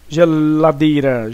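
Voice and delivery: slow "Geladeira" with a held l; stretched to find stress. Shown as a demonstration. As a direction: draw out "Geladeira", lingering before "dei". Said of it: "Então o dei".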